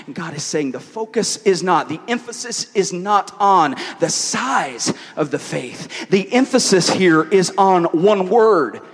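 emphatic, projected sermon delivery; preaching. Real, not acted. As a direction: preach it to the room, driving each point home. Preaches at full force.

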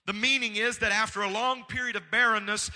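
loud, high preaching with weighty stresses; forceful preaching. Real, not acted. Preacher driving a point home to the congregation, forceful and loud. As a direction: preach it out at full voice, building in pitch.

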